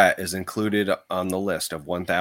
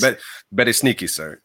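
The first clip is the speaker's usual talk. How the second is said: butler impression; 3 semitones higher than usual. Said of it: "as the, the butler".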